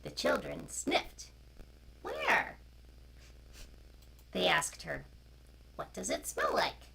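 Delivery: loud, slow storybook reading; reading to children. A narrator's reading. Storyteller reading a picture book to children online. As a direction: read it out to the little ones, clearly.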